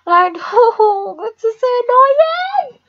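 high-pitched giggling through the words, helplessly; can't stop cackling at the game; odd high laugh. Real, not acted. Bored gamer cracks up at silent players, high giggling. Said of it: "Why do I laugh like that?".

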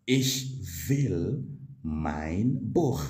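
slow, careful German model line; slow model sentence. Shown as a demonstration. Teacher slowly modelling the German sentence for students. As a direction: spell it out slowly for the learner. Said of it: "You will simply say".

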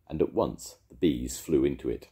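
ordinary delivery

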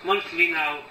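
deliberate recital, pressing on key words; poem reading. Acted. Poet reading about a father's grave, firm deliberate recital. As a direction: recite the verse firmly, pausing between phrases.

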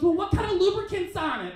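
high-pitched mock interrogation; put-on high voice of a wary date; pressing question.

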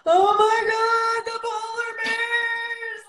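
high, loud, drawn-out cry of despair; mock anguished wail. Mother reacting to her daughter's bleak warning, anguished wail. Mother retells her panic over polar bears.

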